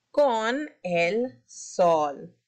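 con el sol drawn out slowly; slow reading. Shown as a demonstration. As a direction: slowly finish the line. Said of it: "levanten la mano si escuchan".

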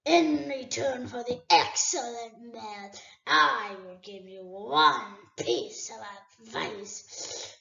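a great dragon toying with a thief; dragon Smaug. Acted. Smaug warns Bilbo.

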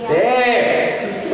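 high drawn-out Armenian dad voice; a high, long, accusing Armenian dad; dad's accent. Retold or imitated. Armenian dad calling his son, voiced high. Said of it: "he'll look at me and he'll go".

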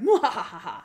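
playful villain cackle; hammed-up villainous mwahaha; mock evil laugh. Poisoner after her plan is accepted, mock evil laugh.

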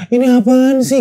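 high-pitched mock whine; complaining cry. Survivor re-enacting his crying in the water, complaining whine. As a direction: whimper it as a complaint, acted. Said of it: "nangis mengeluh".

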